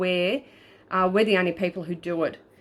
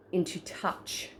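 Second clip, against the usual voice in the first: whispered; 10 dB quieter, slower than usual. Shown as a demonstration. Explaining the device, dramatic close.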